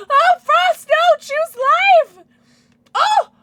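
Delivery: high, loud pleading yells; desperate cheer. Real, not acted. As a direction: scream encouragement at the screen. Cheering a ball on.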